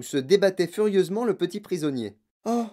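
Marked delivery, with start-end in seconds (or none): 2.4-2.7 high-pitched wailing appeal; higher, distressed address; lamenting plea. Acted. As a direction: moan it imploringly, pitch raised. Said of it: "se lamenta madame Tivy".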